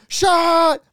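high, loud drinking-game call; drawn-out, high party shout; calling out shot. Retold or imitated. Retelling drinking game, calls out shot.

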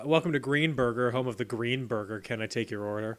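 low, soft counter greeting; a hint of a bored fast-food worker; a worker greets customers, low and soft; lightly voiced cashier.

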